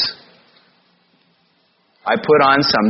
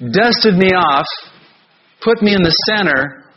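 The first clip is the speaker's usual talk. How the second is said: ordinary delivery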